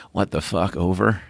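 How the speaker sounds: lazy, half-baked radio reply; stoned voice. Retold or imitated. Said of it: "you get this really stone voice on the air".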